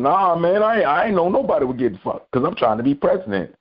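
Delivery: a mild mocking imitation; mocking Jim Jordan. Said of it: "Jim Jo-, Jim Jordan".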